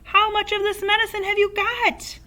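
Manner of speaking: high, loud eager question; excited shout. A narrator's reading. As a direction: demand it breathlessly. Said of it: "Mister Cranky shouted".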